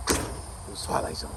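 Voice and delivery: usual speaking voice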